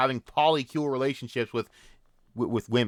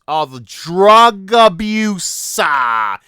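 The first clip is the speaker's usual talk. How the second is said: mock reveal; 5 semitones higher, 10 dB louder, much slower than usual. Real, not acted. Mocking rant, slow loud reveal.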